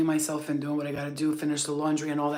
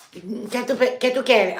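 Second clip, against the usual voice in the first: put-on higher, louder curt Spanish-accented demand; Dominican Spanish clerk; 5 semitones higher, 6 dB louder, slower than usual. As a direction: snap it like a rude Uptown clerk. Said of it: "It's like".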